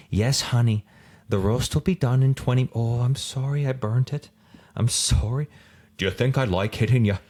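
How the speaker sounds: lightly voiced timid housewife; mock timid housewife. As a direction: ham up a nervous housewife gone sour. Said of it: "she sounds like a housewife".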